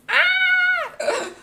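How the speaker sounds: high, loud shriek of delight; real excited scream. Real, not acted. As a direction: let out a joyful scream. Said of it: "if I'm screaming in your ear".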